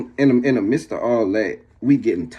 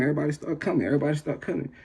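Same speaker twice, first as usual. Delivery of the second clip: ordinary talk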